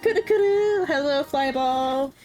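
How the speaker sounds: put-on high bubbly valley-girl voice, drawn out; California girl. Gamer friend greeting Flyball as a California girl, high and bubbly. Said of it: "me doing California girl".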